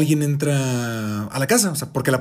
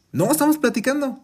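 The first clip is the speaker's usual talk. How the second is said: high, disbelieving protest; baffled protest; 11 semitones higher than usual. Retold or imitated. Mom telling grandma they'd just been chatting, high and baffled. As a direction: insist it, half laughing in disbelief. Mother insists they were talking.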